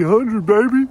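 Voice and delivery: hyped. Real, not acted. Hyped sign-off.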